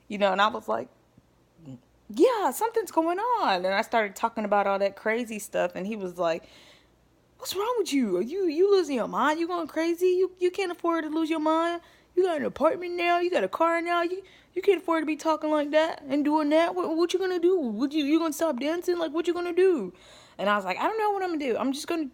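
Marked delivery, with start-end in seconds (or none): 2.1-3.7 hinting something's wrong. Retold or imitated. Her own reply to ex. 7.4-19.9 much higher, louder mock-lecturing voice; scolding ex. Ex scolding her for losing her mind, high and loud.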